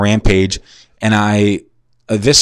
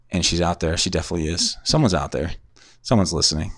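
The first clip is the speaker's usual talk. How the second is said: soft, lowered voice to yourself; hushed aside; 3 semitones lower, 8 dB quieter, faster than usual. Real, not acted. Host sure someone is listening outside, hushed distracted aside. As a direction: keep it down, someone may be listening.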